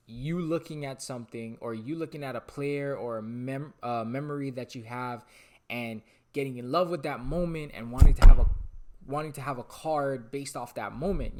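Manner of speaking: ordinary talk, nothing marked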